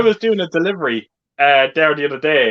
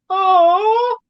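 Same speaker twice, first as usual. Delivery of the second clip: long, high drawn-out "oh"; high mimicked "ohhh"; imitated cooing; 14 semitones higher, 3 dB louder than usual. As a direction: coo it high and hold it, mimicking someone. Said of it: "I can just hear Ellen downstairs now going".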